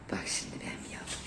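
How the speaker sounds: soft whisper to the pet. Real, not acted. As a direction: hush it to your little one. Whispering to her arriving pet. (fısıltıyla)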